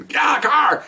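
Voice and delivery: high-pitched retold yell; high, strained mock shout; mock scream. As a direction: imitate a partner screaming an order, without full volume. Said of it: "my partner's screaming".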